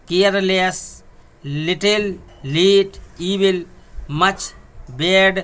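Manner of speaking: slow, emphatic list read word by word; teacher dictating the practice words. Shown as a demonstration. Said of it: "आपके practice के लिए हैं".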